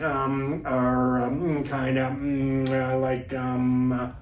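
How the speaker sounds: droning string of drawn-out fillers; filler sounds. Shown as a demonstration. Coach mimicking fillers to avoid, droning hesitation. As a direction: stall on one steady note. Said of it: "the vocalizations like".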